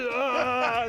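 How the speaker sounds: high imitation scream; mock pained scream. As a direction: act out a man yelling after hurting his foot. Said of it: "he went to start screaming".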